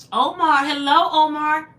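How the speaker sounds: high, loud, drawn-out hello; loud high welcome to a viewer; excited greeting. Real, not acted. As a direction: sing out the name to a friend. Greeting a live chat viewer.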